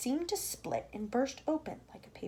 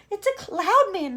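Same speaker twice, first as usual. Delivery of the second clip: high, loud slow cry; frightened cry; 9 semitones higher, 9 dB louder, slower than usual. Acted. Miss Spider crying out at the cloud man, high and loud. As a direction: cry out in fear. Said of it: "Miss Spider cried".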